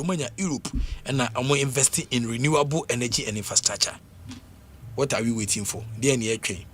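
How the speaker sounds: ordinary talk, nothing marked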